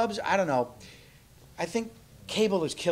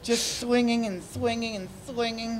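higher, slow campy diva voice; 7 semitones higher, 5 dB louder, slower than usual. Pretend. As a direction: purr it like an aging starlet. Diva puppet persona.

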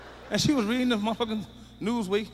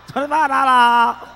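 mock salon worker; 3 semitones higher, 9 dB louder, slower than usual. Repeats the price, slower.

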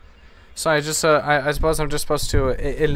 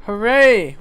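higher, louder hooray; celebratory cheer; 5 semitones higher, 6 dB louder than usual. Real, not acted. Player celebrates unlocked district content, cheering. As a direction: cheer the little win out loud.

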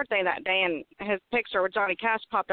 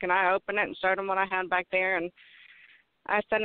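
normal talking voice

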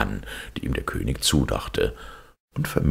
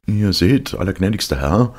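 courtly marquis; 6 semitones higher, 5 dB louder than usual. Acted. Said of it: "sagte der Marquis von Carabas".

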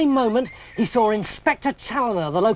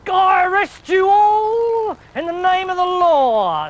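much higher, loud, slow shout; policeman's shout; 10 semitones higher, 5 dB louder, slower than usual. A narrator's reading. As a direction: bellow the arrest at the crowd. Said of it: "said Inspector Challoner".

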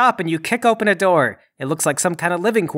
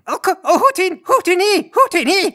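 high, agitated alien chatter; little alien woman; 12 semitones higher than usual. Acted. Alien woman at intruders in her room, high squeaky protest. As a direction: chirp alien gibberish in a tiny high voice. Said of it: "there's a little alien woman".

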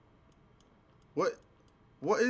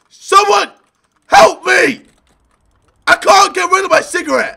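high, loud cries for rescue; calling for help; 6 semitones higher, 8 dB louder than usual. Pretend. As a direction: yell out as if stuck and desperate. Panicked gamer calling for help.

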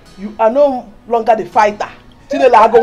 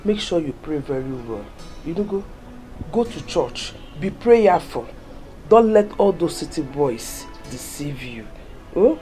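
low, soft counsel; earnest motherly advice; 11 semitones lower, 7 dB quieter than usual. Acted. Mother advising daughter to take care in the city, tender. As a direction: advise gently in a low, hushed register.

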